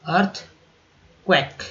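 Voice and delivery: Earthquake said slowly, arth-kwek; slow drawn word. Shown as a demonstration. Teacher drills earthquake for the class. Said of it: "अब देखिए भूकंप".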